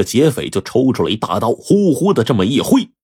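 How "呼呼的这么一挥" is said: ordinary delivery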